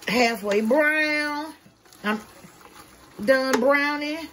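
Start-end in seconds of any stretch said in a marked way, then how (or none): none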